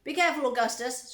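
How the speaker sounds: loud warning shout, pitch lifted. A narrator's reading. Father warning his son by the river, shouting. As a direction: shout a warning to your child. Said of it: "shouted Mister Gloop".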